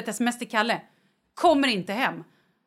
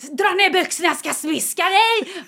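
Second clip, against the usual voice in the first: higher, louder playful threat; mock threat; 7 semitones higher, 6 dB louder than usual. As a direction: bark it as a cheeky dominatrix. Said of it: "Jag bara". (skämtsamt hotfullt, högre och starkare)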